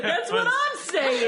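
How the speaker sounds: high, loud, drawn-out comeback; hammed-up, slow high yell; mock protest. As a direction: stretch it out in comic protest. Acted comeback in a retold exchange.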